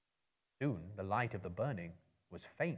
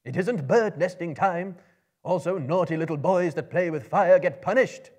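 loud, higher scolding, like a stern schoolmaster; scolding, taunting; 8 semitones higher, 12 dB louder than usual. A narrator's reading. Gandalf scolds the goblins to make them angry, loud and high. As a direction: tell off naughty boys to provoke them. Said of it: "He said it to make them angry".

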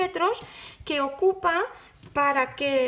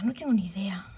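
whispered aside; 5 semitones lower than usual. Real, not acted. Whispers "no tengo ni idea" to herself after telling viewers. Repeats it under breath.

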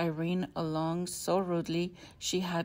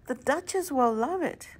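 put-on little girl voice; 7 semitones higher, 4 dB louder than usual. A narrator's reading. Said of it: "said her daughter Irene".

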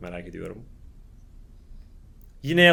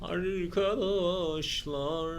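eerie drawn-out call; 9 dB quieter, much slower than usual. Pretend. Slow eerie calling to viewers.

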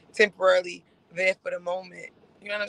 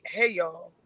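imitating Chanel; 4 semitones lower, 5 dB quieter than usual. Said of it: "I can see her voice. She like".

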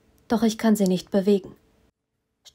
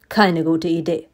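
low, eerie voice inside her head; Poison's voice speaking inside her mind; 3 semitones lower than usual. A narrator's reading. Villain speaking into her head, low and eerie. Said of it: "sagt er in meinem Kopf".

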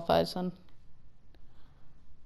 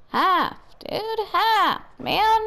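pitched-up whining call for help; whiny high call; 10 semitones higher, much slower than usual. Real, not acted. Calling a teammate for help.

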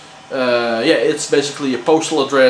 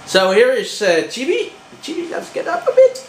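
high, sweet voice for a pet; high pet talk; 10 semitones higher than usual. Real, not acted. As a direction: coo at the dog.

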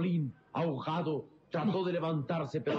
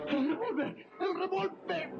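urgent cry. Acted. Boy urging to grab gun.

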